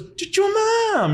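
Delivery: long, high, loud "Chichona!" yelled with a laugh; Hmong parent's shout. Retold or imitated. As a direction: holler "Chichona!" like a mom hurrying her kid. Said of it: "What does".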